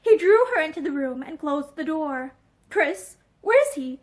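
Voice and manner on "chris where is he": ordinary delivery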